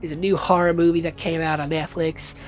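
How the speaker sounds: put-on half-hearted Southern American accent; bad Southern American accent. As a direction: play a Southern American, badly and on purpose. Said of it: "I'm gonna stop with that accent 'cause it's terrible".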